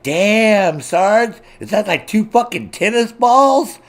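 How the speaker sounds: high, loud gawking exclamation; mock amazed reaction. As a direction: act stunned by the size of it. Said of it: "You'd be like".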